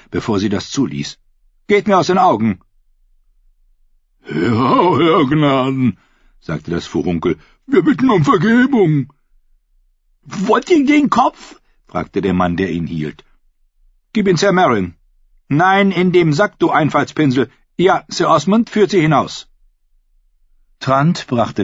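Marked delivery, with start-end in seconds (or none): 1.7-2.6 angry queen. Acted. Queen dismisses the fools. 4.3-6.0 very high, slow dim-witted voice; simple fool voice. A narrator's reading. 7.7-11.6 simple fool voice. A narrator's reading. 14.1-19.5 higher, louder irritated royal voice; impatient queen. A narrator's reading.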